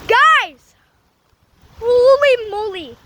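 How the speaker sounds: loud, drawn-out amazement; excited shout. Real, not acted. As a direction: call out in awe. Storm intensifies.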